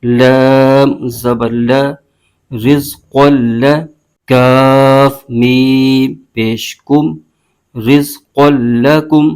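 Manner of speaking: slow, drawn-out chanted spelling of letters and vowel marks; Quran spelling drill. Shown as a demonstration. Teacher spelling a Quran word for students, slow recitation chant. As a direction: chant each letter and mark slowly, like a tajweed teacher. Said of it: "اس کے ہجے یوں کریں گے".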